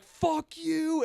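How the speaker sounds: high mock snarl; imitated kid. Son cursing his mom, high mock voice. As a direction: play the bratty son. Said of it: "he was like".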